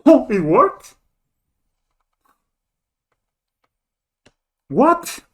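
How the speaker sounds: sudden high, loud disbelief; stunned surprise. Real, not acted. Player reacting as the opponent lets the combo through, shocked. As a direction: jump up in pitch, caught off guard.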